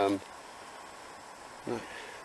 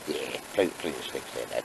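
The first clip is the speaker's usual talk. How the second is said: dog voice; 7 semitones lower, 6 dB quieter than usual. Pretend. Voicing the dog.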